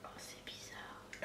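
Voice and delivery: hushed puzzled whisper. Real, not acted. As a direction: breathe it out, puzzled. Tasting the soda.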